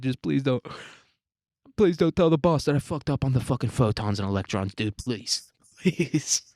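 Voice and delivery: hammed-up pitiful pleading; panicked begging. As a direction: beg desperately not to be reported. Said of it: "panicking on his computer".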